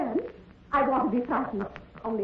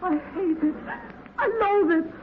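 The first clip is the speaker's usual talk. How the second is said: higher and louder, rising to a desperate cry; desperate pleading; 6 semitones higher, 4 dB louder than usual. Acted. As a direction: plead for your life in despair. Water rising, pleading to live.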